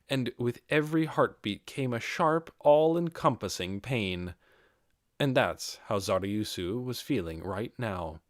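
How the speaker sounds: plain reading voice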